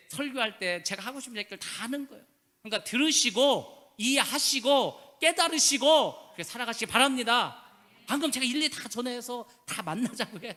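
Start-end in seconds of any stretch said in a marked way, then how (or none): 2.6-7.6 emphatic plea. Real, not acted. Pastor urging congregation to understand.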